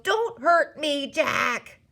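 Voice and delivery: high, loud, drawn-out begging; yelled. A narrator's reading. Terrified giant pleading with Jack, yelling. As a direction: plead at full voice, slowly. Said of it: "he yelled".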